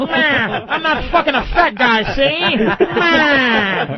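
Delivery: mocking old-movie gangster voice with drawn-out bleats; Edward G. Robinson. Host teasing a woman about her voice, gangster caricature. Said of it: "Robinson voice with titties".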